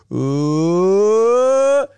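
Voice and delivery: long rising "ooh", then a loud high yell; drunken hoons. Retold or imitated. Re-enacting drunken hoons at a Two Up game, loud drunken whooping. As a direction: imitate a rowdy beer-soaked circle cheering. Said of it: "all these drunken hoons in a circle with beer going".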